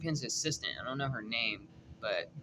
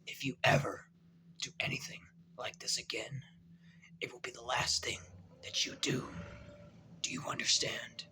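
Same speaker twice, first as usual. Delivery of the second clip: quoting Kingpin's menacing whisper; threatening whisper; 3 semitones lower, 7 dB quieter than usual. Retold or imitated. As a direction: breathe the threat out in a low whisper. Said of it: "Kingpin said to him".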